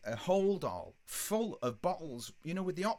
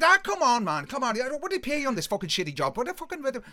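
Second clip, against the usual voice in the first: high, raised, insistent pleading; loud cajoling; 9 semitones higher, 8 dB louder, faster than usual. Retold or imitated. Ringleader persuading the bus driver, loud cajoling. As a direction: badger him high and loud.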